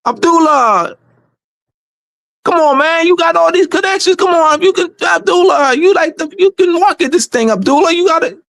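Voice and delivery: imitating a friend's urgent pep talk; Nicholas. Friend kicking him in the butt to get moving, retold pep talk. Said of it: "Nicholas had to kick me in my butt".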